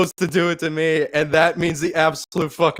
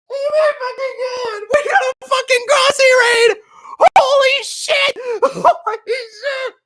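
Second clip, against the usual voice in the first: high-pitched squealing disbelief, breaking into laughter; real overwhelmed shock. Real, not acted. Streamer just got raided, squealing in happy shock. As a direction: squeal as if you can't believe your luck.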